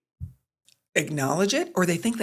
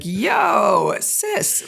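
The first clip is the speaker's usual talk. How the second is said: teasing big-sister slang; 2 semitones higher, 3 dB louder than usual. Retold or imitated. Mom playfully calling her daughter sis, teasing. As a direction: tease her like a cool older sibling. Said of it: "And I was like".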